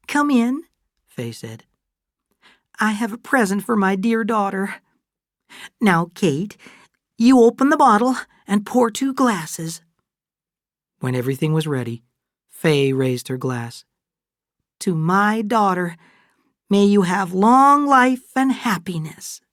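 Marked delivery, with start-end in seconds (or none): 0.1-9.8 higher, lightly voiced older woman; a fond mother offering a gift; touch of a proud older lady; Fay's voice. A narrator's reading. Fay, the older woman, speaks. 14.8-19.4 higher, lightly voiced older woman; a fond mother offering a gift; touch of a proud older lady; Fay's voice. A narrator's reading. Fay toasts her daughter.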